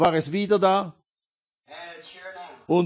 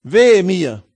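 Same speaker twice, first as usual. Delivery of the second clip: crying out; 7 dB louder than usual. Retold or imitated. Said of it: "rief er".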